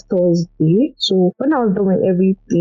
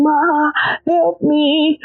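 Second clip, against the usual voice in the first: retold scream; 8 semitones higher, much slower than usual. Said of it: "I was just screaming".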